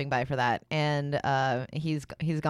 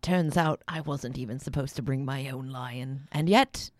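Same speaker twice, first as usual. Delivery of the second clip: whispered mock Michael Caine accent, slowing at the end; hushed mock Michael Caine accent; Michael Caine impression; 4 semitones lower than usual. Co-host goes on whispering in a mock Michael Caine accent. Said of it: "let's talk like Michael Caine".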